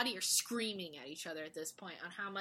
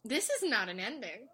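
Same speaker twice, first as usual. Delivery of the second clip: mock outrage; 7 semitones higher, 5 dB louder, slower than usual. Own indignant reaction to ending.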